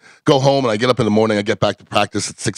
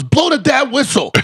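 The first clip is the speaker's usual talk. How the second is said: high, slow barked command; mock shouted order; 13 semitones higher than usual. Quarterback ordering the coach to start the run test, shouted. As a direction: yell it like a hungover QB who can't wait. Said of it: "and he goes".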